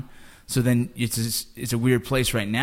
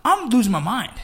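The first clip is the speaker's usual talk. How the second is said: excited; 10 semitones higher than usual. Real, not acted. Retelling disbelief.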